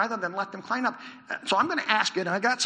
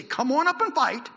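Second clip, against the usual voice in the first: higher, louder called-out challenge; enemy challenge; 8 semitones higher, 7 dB louder than usual. Retold or imitated. Preacher voicing the enemy soldiers' challenge, called out. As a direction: call it out like a soldier daring them. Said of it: "If they say".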